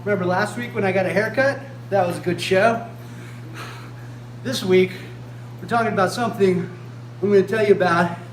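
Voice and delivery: put-on loud, chirpy children's TV host; overbright kids party host. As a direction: beam at the kids, loud and eager. Kids show host greeting.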